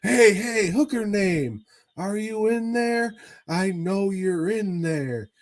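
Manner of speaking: mock pimp shouting. Pimp pounding on hotel door.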